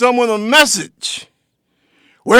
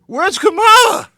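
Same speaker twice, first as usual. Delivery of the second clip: mock panic; 7 semitones higher than usual. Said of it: "and they say".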